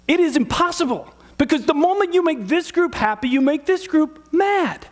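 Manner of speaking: high-pitched, insistent preacher's emphasis; impassioned, pitch lifted high on every phrase; emphatic preaching. Real, not acted. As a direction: drive the point home from the pulpit. Preacher driving home his main point.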